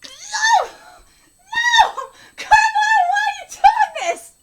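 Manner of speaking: high, loud wail of dismay; anguished protest. Real, not acted. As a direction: cry out against the betrayal. Protesting the story's turn.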